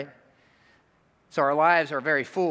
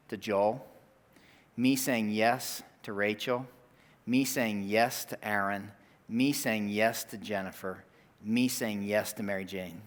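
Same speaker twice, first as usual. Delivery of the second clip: slow, measured, lower delivery; tender, deliberate list, settling quieter; heartfelt; 3 semitones lower, 3 dB quieter, slower than usual. Real, not acted. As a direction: land each name softly and slowly, pausing between. Tender point about family.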